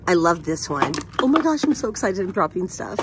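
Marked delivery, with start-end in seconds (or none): none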